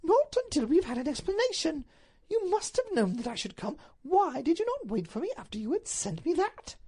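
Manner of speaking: high, indignant lady's voice; high-pitched wifely insistence; insistent Duchess. Acted. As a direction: refuse firmly and demand answers. Duchess voiced, demanding explanation.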